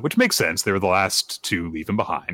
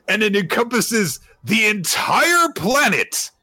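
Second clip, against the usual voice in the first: epic climax; 12 semitones higher, slower than usual. Acted. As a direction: proclaim it like the earth itself is shaking. Climax of narrated explosion.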